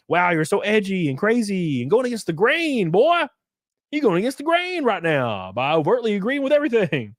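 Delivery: high-pitched mock amazement; mock awe with swooping pitch; sarcastic mockery. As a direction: gush sarcastically at a would-be rebel. Sarcastically mocking fake rebels.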